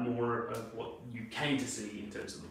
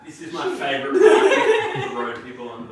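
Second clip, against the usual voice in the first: laughing; 5 semitones higher, 15 dB louder than usual. Real, not acted. Teasing colleague, laughing.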